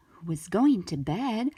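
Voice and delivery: plain reading voice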